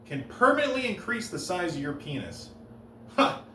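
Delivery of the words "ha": usual speaking voice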